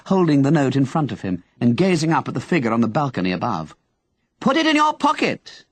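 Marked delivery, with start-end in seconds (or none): 4.4-5.7 shout. Acted. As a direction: shout it down, much higher. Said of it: "Henry shouted".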